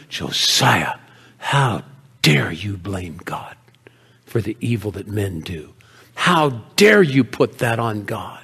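stern rebuke. Acted. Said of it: "she was rebuking me that day".

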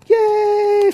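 very high squealing cheer; cheerleader cheer. Retold or imitated. Cheerleaders rooting for the football players, in a high squeal. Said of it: "would, you know".

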